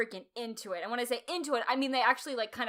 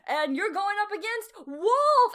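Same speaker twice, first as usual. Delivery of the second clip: higher, slow hyped announcer; 10 semitones higher, 6 dB louder, slower than usual. Retold or imitated. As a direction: boom it like an arena announcer. Imitating the announcer.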